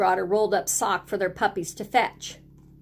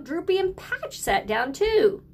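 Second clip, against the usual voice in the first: ordinary delivery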